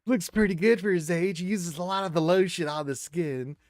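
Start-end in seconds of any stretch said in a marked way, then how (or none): 0.0-1.8 high, loud announcer voice; hammed-up birthday announcer; mock announcer. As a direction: announce it to the crowd like a showman.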